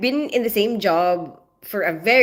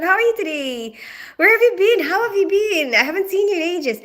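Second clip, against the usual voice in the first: higher, gushing welcome back; excited greeting; 8 semitones higher than usual. Real, not acted. Welcoming Mad Dog back to the stream, high eager gush. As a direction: pour on the gladness at seeing her.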